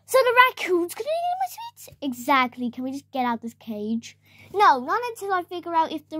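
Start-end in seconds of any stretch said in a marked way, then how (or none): 0.1-1.9 much higher, squeaky alarmed question; high squeaky toy voice. Acted. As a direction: squeak it out in a panic. Alarmed toy voice after gasp.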